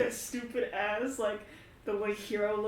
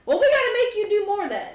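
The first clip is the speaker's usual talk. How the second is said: high, quick, loud push; acted insistence; 10 semitones higher, 6 dB louder, faster than usual. Character pushes the one who hasn't done much, insistently. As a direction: insist in a raised, hurried voice.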